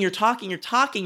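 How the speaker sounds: normal talking voice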